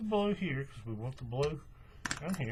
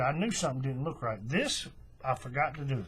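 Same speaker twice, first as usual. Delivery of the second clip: ordinary talk, nothing marked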